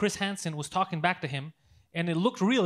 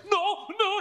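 high panicked no, laughing; 13 semitones higher than usual. Retold or imitated. Old man panics and leaves, high laughing no. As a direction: imitate the old man's high flustered refusal.

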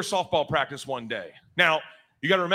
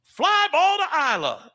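high, chirpy coach, slow on the last call; drawn-out high coach announcing a fly ball; mock coach voice; 11 semitones higher, slower than usual. Coach calling a fly ball to Isla, high and slow. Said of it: "Coach is like".

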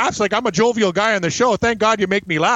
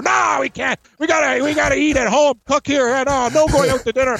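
mock snapping; 6 semitones higher than usual. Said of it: "He snaps on her".

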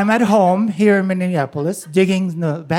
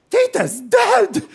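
put-on high, slow kid's voice; a nephew shouting the obvious; blurting boy; 3 dB louder, much slower than usual. Nephew shooting off the couch, high and slow.

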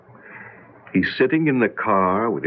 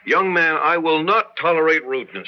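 raised, stern fatherly reprimand; stern rebuke; 6 semitones higher than usual. Acted. Father scolding rude son at breakfast, stern. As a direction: put the boy in his place.

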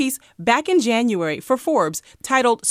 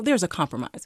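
usual speaking voice

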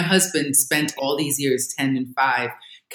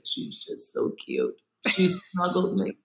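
soft, slow, whispery adoring coo; besotted dad; 8 dB quieter, slower than usual. Retold or imitated. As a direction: imitate a smitten father cooing softly. Besotted husband imitated at night.

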